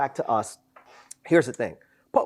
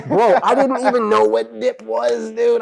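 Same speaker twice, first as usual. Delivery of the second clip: laughing it out, slower; 8 semitones higher, 6 dB louder than usual. Real, not acted. Laughing at himself to a friend about not knowing dip. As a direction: disbelief breaking into laughter. Laughing at his own naivety.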